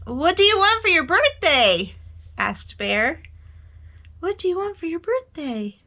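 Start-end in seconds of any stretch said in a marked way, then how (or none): 0.0-1.9 high, loud eager question; excited high-pitched call. A narrator's reading. Bear calls across to the moon. 4.2-5.8 high echoed question, held back; high voice, less forceful. A narrator's reading. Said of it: "his own voice echoed".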